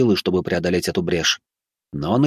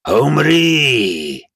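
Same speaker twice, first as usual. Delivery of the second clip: roaring death command; angry demon; 4 semitones higher, 4 dB louder than usual. A narrator's reading. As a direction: bellow the kill order. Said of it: "гневно проговорил демон".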